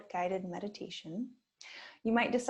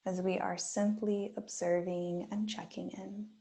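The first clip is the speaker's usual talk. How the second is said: guided meditation. Acted. Yoga teacher guiding students through a body scan, gently. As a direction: ease listeners into stillness with long pauses.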